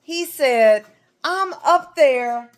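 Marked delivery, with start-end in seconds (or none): 1.2-2.5 slow, raised-pitch indignation; mock-indignant protest; upset Moses. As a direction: draw it out, acting upset. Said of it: "he was so upset".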